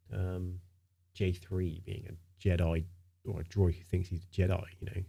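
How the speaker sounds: normal talking voice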